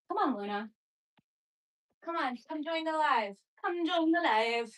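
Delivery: soft, coaxing call to Luna; coaxing the cat. Real, not acted. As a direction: gently lure the cat in. Calling the cat softly.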